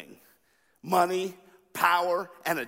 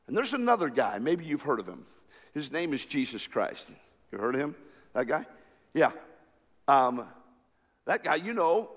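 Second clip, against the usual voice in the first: normal talking voice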